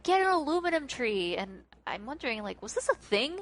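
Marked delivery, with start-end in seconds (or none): 0.0-1.4 raised-pitch mock enthusiasm; imitated raving. Host quoting the kids raving about the tree, mock enthusiasm. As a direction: rave like an excited crowd, lightly acted. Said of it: "they're all raving about".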